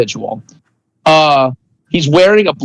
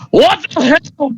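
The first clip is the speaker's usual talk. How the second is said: high, strained shout of rage; angry yell; 11 semitones higher than usual. Acted. Angry guard swearing at the doctor. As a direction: bark it out at the doctor in fury. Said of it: "he just starts yelling really, really loud".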